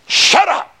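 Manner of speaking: sharp, high shout of "Shut up!"; angry shout. Retold or imitated. Husband snapping back at his wife, high angry shout. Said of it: "you say".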